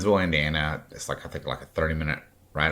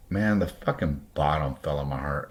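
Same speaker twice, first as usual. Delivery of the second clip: ordinary talk, nothing marked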